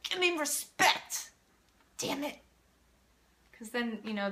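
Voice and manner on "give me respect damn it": mock angry demand, high and slow; a hammed-up, slow, high-pitched demand for respect. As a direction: bark it like a parent insisting on respect. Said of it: "and be like".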